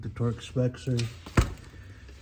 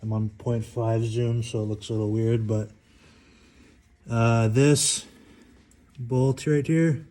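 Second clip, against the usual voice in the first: lying under a car; 7 dB louder than usual. Real, not acted. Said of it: "I'm under the car right now".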